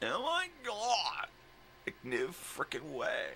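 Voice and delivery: very high, slow, whiny pretend voice; mock exasperation. Owner voicing the dog's outrage, high and slow. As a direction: ham up disbelief, way up high.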